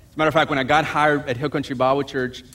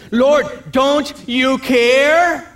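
higher, very slow complaint; plaintive plea; 8 semitones higher, 5 dB louder, much slower than usual. Retold or imitated. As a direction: drag it out like a hurt plea. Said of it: "in this passage it says".

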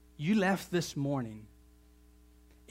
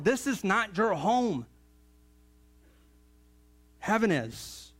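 raised, slow, emphatic scripture preaching; full-force preaching; 6 semitones higher, 4 dB louder than usual. Real, not acted. Preacher reading Jesus' words to the church, full-force preaching. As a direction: proclaim the verse high, stretching the key words.

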